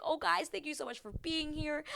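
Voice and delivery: high, excited thanks; excited groom. Retold or imitated. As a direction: imitate a thrilled groom greeting guests. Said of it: "He's super excited".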